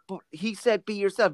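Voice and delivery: high-pitched, defensive retort; mock protest. Student answering the head of acting's criticism, protesting. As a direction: imitate a student pleading their case.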